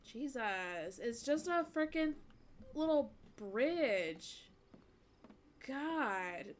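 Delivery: loud, exasperated, dragging out the last words; exasperated outburst. Real, not acted. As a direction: vent at the screen in disbelief. Exasperated at a game character.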